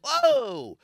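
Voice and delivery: very high, drawn-out reaction; mock startled whoa. Woman hearing the big guy's odd voice, mock startled.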